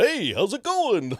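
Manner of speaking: high, loud cartoon caveman greeting; chummy dopey cartoon buddy; Barney Rubble voice. Shown as a demonstration. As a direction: classic Grimace, Barney Rubble style. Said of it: "kinda sounded like Barney Rubble".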